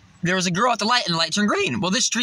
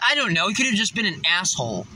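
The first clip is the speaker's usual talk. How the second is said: usual speaking voice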